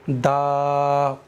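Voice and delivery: दाऊदा with a glottal hamza catch on the ऊ, from the throat; wrong hamza demo. Shown as a demonstration. Teacher showing learners the wrong reading, hamza in throat. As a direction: demonstrate the common mistake, a throaty break before ऊ. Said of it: "ये हमजा की आवाज अदा हो रही है".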